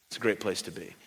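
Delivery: hushed echo. Shown as a demonstration. Preacher echoing his point to the congregation, hushed. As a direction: let it fall to a hush. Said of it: "repeats line, dropping away".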